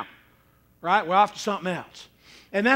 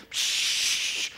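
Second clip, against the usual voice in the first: imitated fizzing sparkler; sparkler hiss; 3 dB quieter than usual. Preacher shows congregation a sparkler lighting, hissed noise. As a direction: let out a sharp "psh" of sparks.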